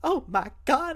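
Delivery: high-pitched, concerned exclamation; high, worried fussing; mother bear alarm. Acted. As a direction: gasp it out like a protective mother. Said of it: "goes into Mother Bear mode".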